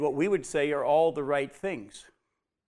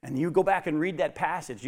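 normal talking voice

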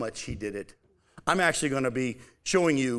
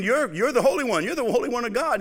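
mock demon cry; 8 semitones higher, 4 dB louder than usual. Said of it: "the demons were saying".

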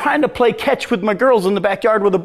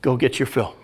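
softer, lower go get your fill; quiet resigned permission; quiet father's permission; 9 semitones lower, 6 dB quieter than usual. Retold or imitated. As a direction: let him go, quietly. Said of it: "what does the father ... say".

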